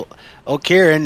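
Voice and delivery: usual speaking voice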